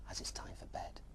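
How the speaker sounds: soft whispered goodnight; bedtime whisper. Real, not acted. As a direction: whisper so as not to wake anyone.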